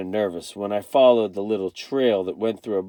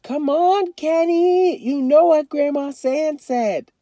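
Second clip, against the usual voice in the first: put-on high, loud, drawn-out child's call; little girl yelling; 4 dB louder, slower than usual. A narrator's reading. Joey calls Kenny to follow the grown-ups' rule, yelling. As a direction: call out to him, slow and pleading. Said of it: "Joey yelled".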